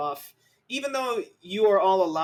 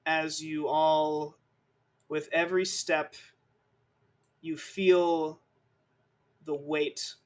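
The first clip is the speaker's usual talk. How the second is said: somber narration. A narrator's reading.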